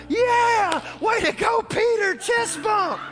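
very high, loud cheer; celebrating shout. Acted. Disciples greet Peter back in the boat, celebrating shout. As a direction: whoop like a teammate after a win.

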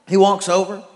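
ordinary talk, nothing marked

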